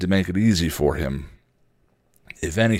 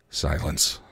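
usual speaking voice

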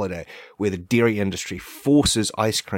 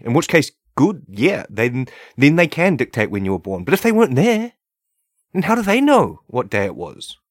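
worked-up rant; 5 semitones higher, 5 dB louder than usual. Real, not acted. Heated rant.

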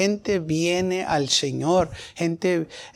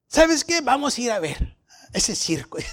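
mocking friend; 6 semitones higher than usual. Amigos aceptan con burla.

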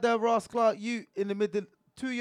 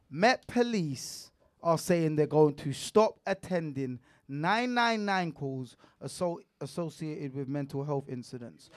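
slow, spaced-out news reading; 3 semitones lower, 3 dB quieter than usual. Shown as a demonstration. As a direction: read it out slowly and deliberately. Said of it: "Let me read that again, slowly".

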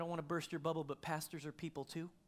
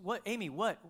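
louder and higher, urgent yell; yelled again; 4 semitones higher, 6 dB louder than usual. Retold or imitated. Husband yelling to his wife from the shower, louder. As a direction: shout her name across the room. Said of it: "And I yelled it again".

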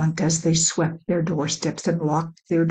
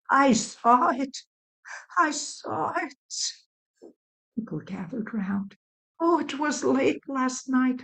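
put-on higher old woman's voice, alarmed and breathless with news; frightened old woman; 4 semitones higher than usual. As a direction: play an old woman who saw the beast in the night, higher. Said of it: "an old woman's voice woke the city".

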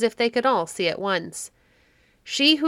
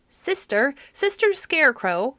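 crying out; 6 semitones higher than usual. A narrator's reading. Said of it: "cried she".

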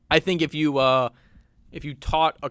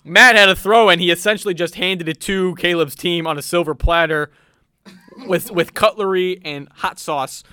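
louder sportscaster quip; play-by-play commentary; 3 semitones higher, 7 dB louder than usual. Acted. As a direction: deliver the joke like a broadcaster.

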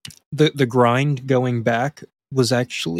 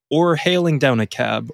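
ordinary talk

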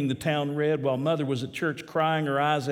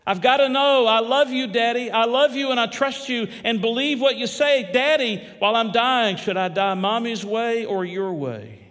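put-on much higher and louder, urgent cry of a dying girl; desperate plea; 7 semitones higher, 7 dB louder than usual. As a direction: plead for the answer with all your heart. Dying daughter pleads with father.

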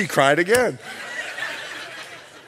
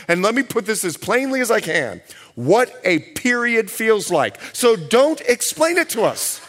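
ordinary talk, nothing marked

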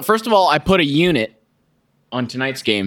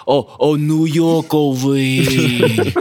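slow, drawn-out mock New York accent; 3 dB louder, much slower than usual. Host retelling his joke in a New York accent. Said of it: "that's my New York accent".

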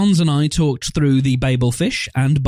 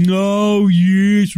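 a mock Brummie crowd, higher and louder; Brummie accent; 6 semitones higher, 3 dB louder than usual. Zombie crowd droning small talk, mock Brummie accent. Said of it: "a bunch of people going".

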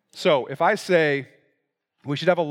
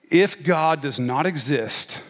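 formal argument recitation; 5 semitones lower than usual. Shown as a demonstration. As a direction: lay out each step deliberately, dropping low on the conclusion.

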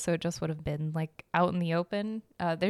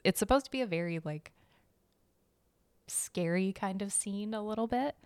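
normal talking voice

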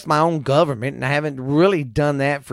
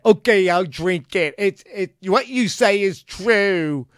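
mock gullible follower; 5 semitones higher, slower than usual. Mocking gullible Kool-Aid drinkers.

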